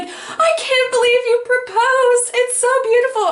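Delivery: re-enacted squeal of delighted disbelief; so excited. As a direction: gush in a high, thrilled voice. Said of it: "I was so excited".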